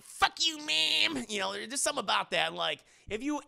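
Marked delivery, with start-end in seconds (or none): 0.0-1.2 put-on little boy. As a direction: squeak it out like a little kid swearing. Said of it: "a little boy saying".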